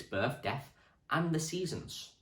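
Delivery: ordinary talk, nothing marked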